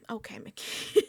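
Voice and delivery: whispered sarcastic okay. Real, not acted. Dismissing Mickey with a sarcastic whisper. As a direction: breathe it, mocking.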